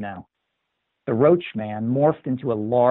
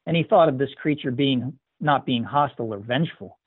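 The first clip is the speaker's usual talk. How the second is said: ordinary delivery, nothing marked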